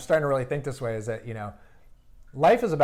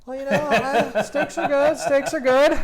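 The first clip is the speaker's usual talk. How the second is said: much higher, louder playful repeat; playful exclamation; 10 dB louder, slower than usual. Real, not acted. Guest joking to the host about steaks, much higher and louder, playful. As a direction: sing it out like a joke.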